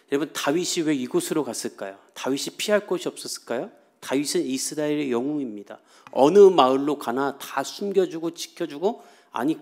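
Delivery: usual speaking voice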